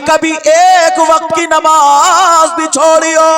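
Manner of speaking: high, loud sermon declamation; full-force preaching. Real, not acted. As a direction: ring it out to the whole crowd.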